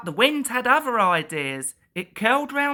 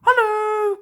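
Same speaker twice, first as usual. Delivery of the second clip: high, loud hello; calling out; 13 semitones higher, 6 dB louder than usual. A narrator's reading. Edward calls to Duck, high and loud. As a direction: call across the yard. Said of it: "called Edward".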